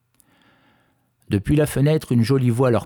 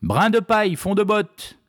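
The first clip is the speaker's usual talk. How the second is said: crying out; 11 semitones higher than usual. A narrator's reading. Fisherman shouts the witch's true name, in a contained high cry. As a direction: call it out high, with the volume held back. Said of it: "cria à plein poumon".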